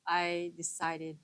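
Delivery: usual speaking voice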